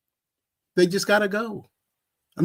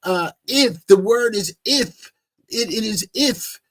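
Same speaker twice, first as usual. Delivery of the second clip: high, mocking imitation of the preacher; 6 semitones higher than usual. Mimicking the preacher's pompous musing to the listeners, lightly voiced. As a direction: lift the voice and ham up his pompous musing. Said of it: "And he was like".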